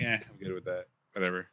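dismissive. Retold or imitated. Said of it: "I'm like".

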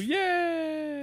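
high, squealing yay; excited cheer. Real, not acted. Host welcoming her co-host back, excited squeal. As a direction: cheer him back in with a burst.

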